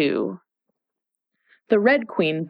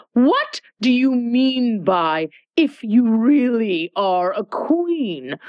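imperious Red Queen; 5 semitones higher than usual. Acted.

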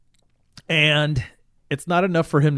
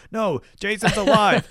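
mock insistence; 7 semitones higher than usual.

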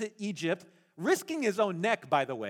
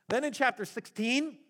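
normal talking voice

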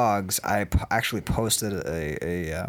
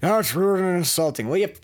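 normal talking voice

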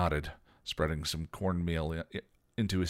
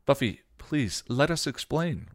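higher pleading plea; begging; 9 semitones higher, 4 dB louder than usual. A narrator's reading. Willow begs Buffy to hear them out. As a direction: beg her to listen. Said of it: "Willow begged".

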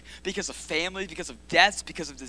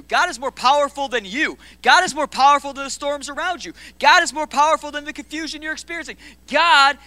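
climbing louder and higher into a preacher's shout; preaching at full force; 8 semitones higher, 13 dB louder than usual. Acted. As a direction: build the crowd up, line by line.

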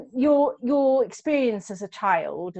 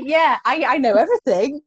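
ordinary talk

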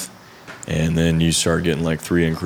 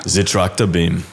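The Tractor Beam with a German "zi" for the; German accent; 2 semitones lower than usual. Retold or imitated. Designer hamming up his former studio's German accent. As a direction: lean into a German accent, the becomes zi. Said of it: "the German accent, that was me".

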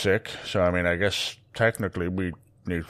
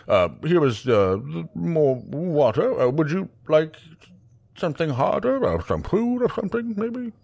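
high, flustered, stammering barkeep; an anxious bartender tripping over his words; nervous bartender; 10 semitones higher, 4 dB louder than usual. Acted. Barry offers more.

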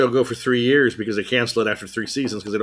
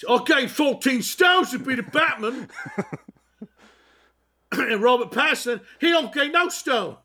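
much higher mock English voice, laughing; high hammed British bluster; mock Bale; 11 semitones higher than usual. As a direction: boast about weight like a pompous star. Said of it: "Bale parody".

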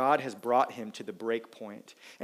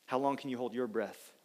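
normal talking voice

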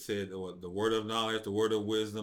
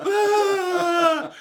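much higher and louder, a hammed-up bawl; mock crying wail; 13 dB louder than usual. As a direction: wail like a grown man crying like a baby. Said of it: "And he goes".

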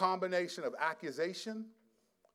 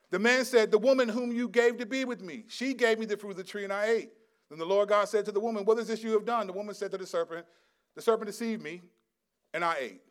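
loud, raised public reading of Genesis; scripture reading; 7 semitones higher, 8 dB louder than usual. Acted. Preacher reading Genesis to his congregation, raised and proclaiming.

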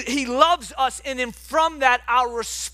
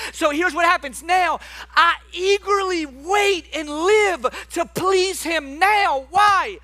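rising sermon fervor; fervent preaching; 6 semitones higher than usual. Acted. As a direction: preach it out with passion. Preaching at full force.

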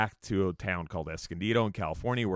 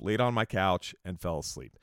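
normal talking voice

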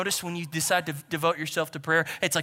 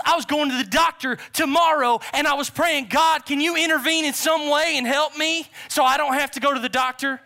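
high, loud, acted elation; overjoyed outburst; 10 semitones higher, 9 dB louder than usual. Preacher voicing the healed man's testimony, excited and loud. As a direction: pour it out loud and high in amazement.

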